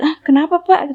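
retold jolt of surprise; startled. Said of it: "Terus saya juga kaget".

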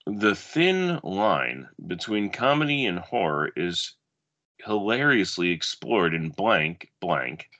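dramatic blurb reading, measured pace; movie blurb read. Acted. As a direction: read it like a video box cover. Reads another movie blurb.

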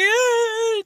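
high, loud mock wail; imitated crying wail. Child crying after falling off the bike, mock wailing. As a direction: wail like a kid who fell. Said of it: "el llorando".

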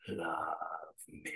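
very low, hushed whisper; eerie whisper. Retold or imitated. Staged ghost woman to one audience member, eerie whisper. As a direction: whisper it like the dead calling out.

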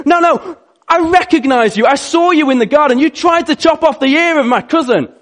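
high, loud, heated insistence; insistent accuser. Acted. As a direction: press the charge hard and high. Servant's cousin insists angrily.

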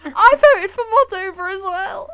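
shouting with joy; loud, high delighted cheer; excited. Real, not acted. Shares a Moldova vote.

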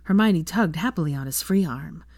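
ordinary delivery, nothing marked

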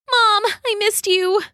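put-on little girl Sasha. A narrator's reading. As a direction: greet mother as an emotional child. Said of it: "Sasha said".

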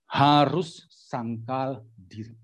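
"Harus sangkal diri" very slow, a pause between words; emphatic repetition. Shown as a demonstration. Preacher drums in first point, "Harus sangkal diri" word by word.

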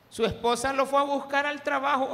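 high, loud eager announcement; loud gossiping call. Retold or imitated. Nosy neighbor shouts to him that his wife went looking, loud and eager. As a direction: shout the news gleefully across the street.

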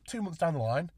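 ordinary talk, nothing marked

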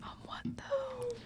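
high soft whisper; ASMR whisper. Pretend. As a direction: keep whispering into the mic. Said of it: "let's do some A S M R".

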